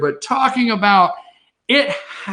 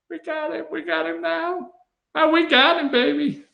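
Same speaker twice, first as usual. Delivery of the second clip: high, gleeful mock triumph; sneering at gloating rivals; 11 semitones higher than usual. Mocking gleeful Democrats.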